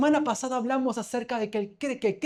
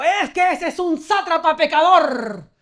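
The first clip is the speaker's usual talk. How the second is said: imitating an angry shout, loud and high; shouted denunciation; 6 semitones higher, 7 dB louder, slower than usual. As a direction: denounce the sinner in a slow, vehement yell. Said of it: "Se paró gritando, dijo".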